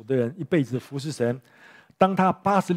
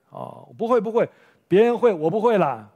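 mock boast; 5 semitones higher, 5 dB louder than usual. Mocking Peter's habitual boasting.

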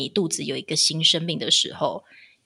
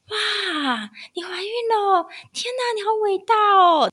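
gushing amazement; 14 semitones higher than usual. Retold or imitated. Acquaintance gushing at the pregnant host, high and excited. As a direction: gasp then gush over her like a fan.

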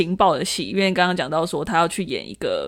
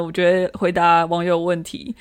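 normal talking voice

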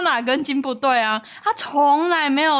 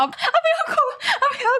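much higher, laughing panicky big-sister voice; mock sister hushing. Big sister smothering crying sibling with a pillow, mock panic. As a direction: beg a crying kid to stop, half laughing.

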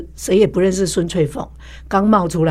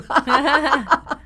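high laugh; real laugh; 8 semitones higher than usual. Real, not acted. Laughs after pain story. (高声笑)

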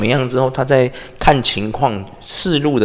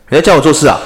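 gangster boss; 2 semitones higher, 7 dB louder, faster than usual. Retold or imitated. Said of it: "香港古惑仔 那种大哥啊".

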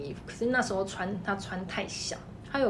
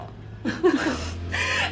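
breathy laugh; 6 semitones higher than usual. Real, not acted. Laughs remembering stress.

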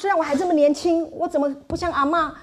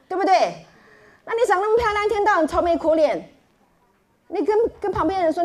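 ordinary talk

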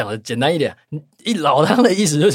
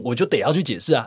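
usual speaking voice

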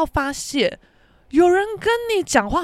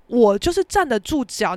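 ordinary talk

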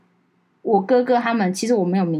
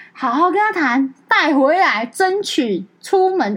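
higher, slow urging; eager sister; 7 semitones higher, 5 dB louder, slower than usual. Retold or imitated. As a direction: press it with bright insistence. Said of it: "他说".

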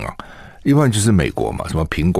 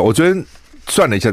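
normal talking voice

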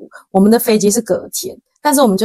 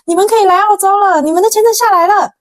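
much higher, louder joyful shout; excited yelling; 12 semitones higher, 4 dB louder than usual. Retold or imitated. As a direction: blurt the good news out at the top of your voice. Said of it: "我就听到阿雅大叫说". (朋友在电话里兴奋大叫：签证下来了)

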